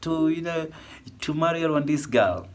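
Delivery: imitating nagging followers, pitched high; high mock questioner. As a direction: voice the pushy people asking. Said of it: "people asking me".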